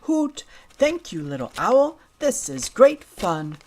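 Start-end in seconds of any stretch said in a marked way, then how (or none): 0.0-0.4 high happy hoot; owl hoot. Shown as a demonstration. Little pig hooting happily at the owl.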